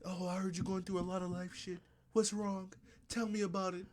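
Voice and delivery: very soft, hushed counsellor-like quote; mock album intro. Co-host mimicking the album's therapy-style intro, soft mock concern. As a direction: imitate a gentle, prompting listener.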